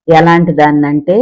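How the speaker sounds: ordinary delivery, nothing marked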